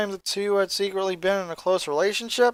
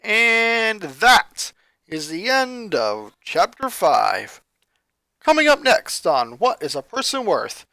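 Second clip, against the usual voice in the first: chapter outro announcer; 3 semitones higher, 6 dB louder than usual. Acted. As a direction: announce the next episode.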